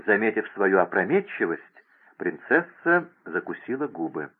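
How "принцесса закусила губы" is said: usual speaking voice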